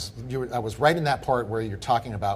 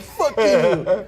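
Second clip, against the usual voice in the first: laughing mock curse; 8 semitones higher, 9 dB louder than usual.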